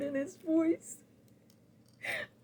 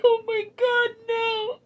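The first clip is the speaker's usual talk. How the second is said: high, tearful cry; breaking down on it; 10 semitones higher, 4 dB louder than usual. Retold or imitated. Reliving her reaction to the news, crying.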